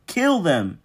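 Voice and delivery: high, loud yelled order; furious yell. Acted. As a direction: scream the command. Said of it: "Harold yelled".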